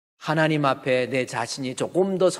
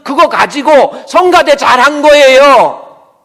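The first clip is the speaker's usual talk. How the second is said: emphatic shout; 8 semitones higher, 13 dB louder, slower than usual. Real, not acted. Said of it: "preacher's loud emphatic punchline".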